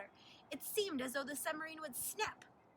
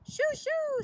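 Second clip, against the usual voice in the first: much higher, louder shooing; 14 semitones higher, 11 dB louder than usual. A narrator's reading. Teacher chases off the Lilliputians, high and loud. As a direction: shoo the tiny people off. Teacher chasing tiny people away.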